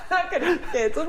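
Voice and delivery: high, giggly reassurance; laughing. Real, not acted. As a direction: laugh through the denial. Laughing off worry about being cancelled.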